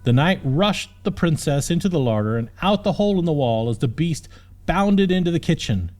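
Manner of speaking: plain reading voice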